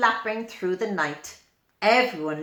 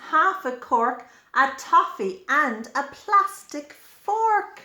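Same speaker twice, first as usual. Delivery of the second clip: plain reading voice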